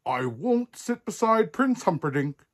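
high, indignant storybook refusal; furious princess. A narrator's reading. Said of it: "Princess Poppy was furious".

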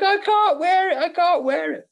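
hammed-up wail of a distraught actor; mock crying. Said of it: "He was like".

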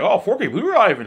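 high, loud mock-impressed Peter. Telling viewers about Peter, imitating him high and loud. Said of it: "He's like".

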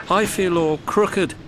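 high, mildly plaintive complaint; complaining. A narrator's reading. Old engine with a broken spring, high complaint. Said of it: "he complained".

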